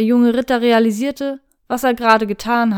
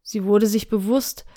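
ordinary delivery, nothing marked

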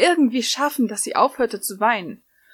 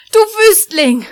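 shrieked insult, much higher and louder; scolding shriek; 13 semitones higher, 11 dB louder, slower than usual. Acted. Lucy shrieking at Natsu who caught her half-naked. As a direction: shriek it at him in outrage. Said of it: "schimpfte sie".

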